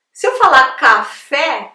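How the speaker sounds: "café" ending in an open é, loud; "café" as a loud teaching model. Shown as a demonstration. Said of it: "aí ele tem o som de E".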